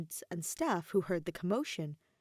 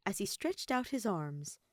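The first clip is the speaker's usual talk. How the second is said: usual speaking voice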